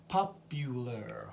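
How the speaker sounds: "Popular" drawn out slowly, each syllable clear; slow, careful "Popular"; slow model word. Shown as a demonstration. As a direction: slowly say "Popular" for learners to copy. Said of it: "slow model repeat".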